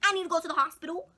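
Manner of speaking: hammed-up loud indignation; exasperated retort. Telling friend's mom she needs the hospital, exasperated.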